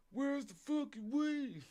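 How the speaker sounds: high, slow mock game-character voice; NPC voice. Streamer imitating the suspect as a street NPC, mock high drawl. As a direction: put on a stretched-out, high-pitched NPC passerby. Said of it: "sound like an N P C passing on the street".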